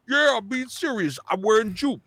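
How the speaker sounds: high-pitched, imitated insistent comedian; Tracy Morgan. Comedian protests to a doubting host, high impression. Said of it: "Tracy Morgan, uh, started talking".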